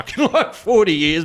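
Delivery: high, loud laughing burst. Real, not acted. Friend laughing while retelling a wild salvia trip. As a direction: laugh it out in disbelief.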